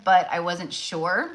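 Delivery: ordinary delivery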